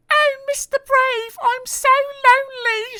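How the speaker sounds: very high, hammed-up sobbing girl voice; mock sobbing. Miss Somersault sobbing to Mister Brave, very high mock wail. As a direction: wail it like a lonely little girl. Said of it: "she sobbed".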